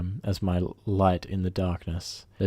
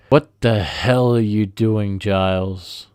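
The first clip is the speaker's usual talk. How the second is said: louder, slow, menacing demand; fuming manager confronting his cook; furious boss; 7 dB louder, slower than usual. Retold or imitated. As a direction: storm in and demand an answer. Boss storming over, fuming.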